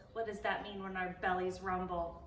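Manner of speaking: plain reading voice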